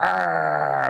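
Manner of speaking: mock monster "arr"; imitated spider snarl. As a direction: growl it as the menacing spider. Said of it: "he was like".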